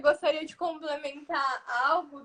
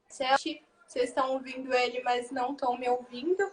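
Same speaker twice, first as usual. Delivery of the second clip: normal talking voice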